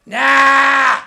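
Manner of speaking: shouted puppet Nah; loud refusal. Acted. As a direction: bark the refusal.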